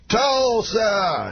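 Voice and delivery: Cosby hyping crowd. Retold or imitated. To the Tulsa crowd, mock Cosby rally call. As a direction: call out the school name, Cosby-style. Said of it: "And everybody screams".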